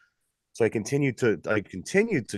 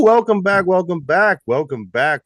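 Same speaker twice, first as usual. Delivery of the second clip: excited; 7 semitones higher, 7 dB louder, slower than usual. Real, not acted. Host's repeated greeting, fading.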